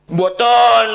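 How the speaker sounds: high, loud frightened no; loud denial. Retold or imitated. Son denying smoking to his angry father, loud and high.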